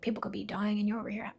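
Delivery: put-on dad grumbling at the kids in the storm; whispered angry dad. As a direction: mutter the father's bitter gripe. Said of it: "my dad was miserable".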